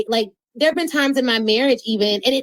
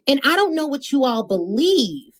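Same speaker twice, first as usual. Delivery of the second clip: ordinary talk, nothing marked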